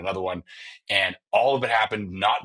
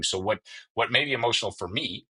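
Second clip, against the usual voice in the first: ordinary talk, nothing marked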